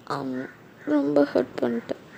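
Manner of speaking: soft, mildly weepy reproach; hurt, tearful. Acted. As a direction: let a touch of sulky hurt show. Hurt bride answering fiancé.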